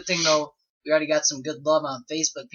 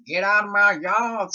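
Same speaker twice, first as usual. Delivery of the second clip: slow, higher southern drawl; southern accent; 5 semitones higher, 3 dB louder, slower than usual. Shown as a demonstration. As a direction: put on a hammed-up southern accent. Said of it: "I can do, like, a southern accent".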